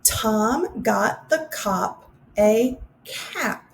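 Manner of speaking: very slow, words spaced for hand-raising; slow answer reading. Shown as a demonstration. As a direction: go through it slowly with answers. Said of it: "give you the correct answers".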